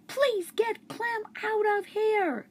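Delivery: put-on high, slow girl's plea; a girl pleading to get the dog out. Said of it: "Miranda yelled".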